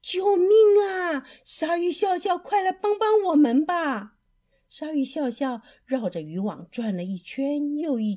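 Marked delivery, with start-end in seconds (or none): 0.0-4.2 high, loud plea from trapped fish; crying for help. Acted. Trapped fish to their shark friend, shouting for help. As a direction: call out urgently for rescue. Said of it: "鱼儿们大叫".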